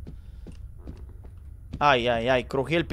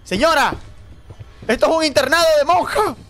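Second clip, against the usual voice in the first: panicked shouting; 7 semitones higher than usual. Real, not acted. Player flees the crazy witch, panicked shouting. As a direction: yell frantically while being chased.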